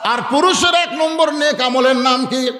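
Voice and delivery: high, sustained preacher's call; rousing preaching. Real, not acted. Preacher quizzing the congregation on good deeds, rousing preaching.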